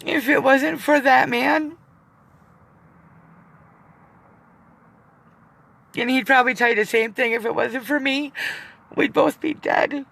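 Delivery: fighting back tears, words breaking; crying. Real, not acted. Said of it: "I hate fucking crying".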